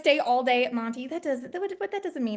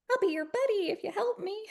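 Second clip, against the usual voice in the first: higher, coaxing; mock sweet bargaining; 7 semitones higher, 3 dB quieter than usual. Indie colleague bargaining for help, mock sweet. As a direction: offer friendship as a deal. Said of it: "Indie is".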